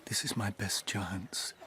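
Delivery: a soft unvoiced whisper of love; whispered confession. Doctor whispering his feelings to his friend's wife. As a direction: breathe it so only she hears.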